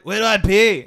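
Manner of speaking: high, loud Scottish exasperation; Scottish accent. Acted. Scottish customer asks a third time, loud Scottish accent. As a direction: holler it as a Scottish lady. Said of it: "this Scottish lady walks up to me".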